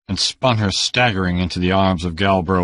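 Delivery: ordinary delivery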